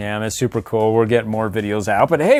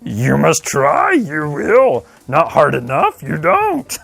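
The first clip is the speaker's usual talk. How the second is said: Yoda; 3 semitones higher, 3 dB louder, slower than usual. Acted. Yoda shaming the helper over the Force, slow put-on voice. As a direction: scold slowly in an alien master's dialect. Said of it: "that's dialect".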